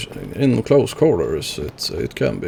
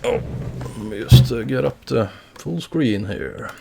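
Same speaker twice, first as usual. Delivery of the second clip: ordinary talk, nothing marked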